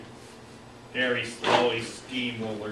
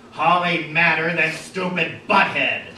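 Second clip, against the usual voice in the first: loud, raised caveman voice; brutish retort; 4 semitones higher, 7 dB louder than usual. A narrator's reading. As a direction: throw it back loudly in brute-speak. Mock brute retort in fight.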